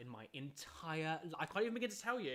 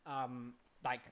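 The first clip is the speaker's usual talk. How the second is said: usual speaking voice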